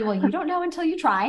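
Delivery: light imitation of an upbeat mother; mother's voice.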